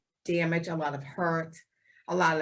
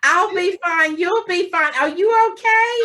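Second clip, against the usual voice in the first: much higher and louder, laughing through it; laughing mock consoling; 9 semitones higher, 6 dB louder than usual. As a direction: ham up comforting someone who is crying. Host mocks crying HR man.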